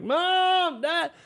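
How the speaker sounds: calling out high and frantic for parents; a scared kid searching an empty house; high panicked cry of Mom and Dad. Retold or imitated. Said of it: "Then it more like".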